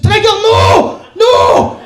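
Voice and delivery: high, loud yell of alarm; panicked shout. Acted. [name] yells at invisible Trigger to stop, panicked shout. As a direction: scream it as a frantic warning.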